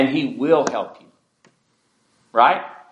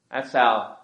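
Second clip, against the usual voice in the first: ordinary talk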